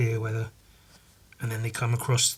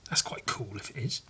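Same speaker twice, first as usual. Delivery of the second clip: whisper; 3 semitones lower, 13 dB quieter than usual. Real, not acted. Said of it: "whispered comment".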